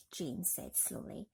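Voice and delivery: plain reading voice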